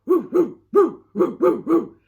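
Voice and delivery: loud, high howling woos; howling dog. Acted. Bottomley Potts the dog howls, loud and high. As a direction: howl like a dog. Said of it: "said Bottomley Potts".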